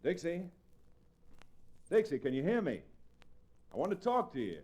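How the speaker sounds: pitched high to carry through a door; calling through door. Acted. Chad calls to Dixie through her bedroom door, pitched high.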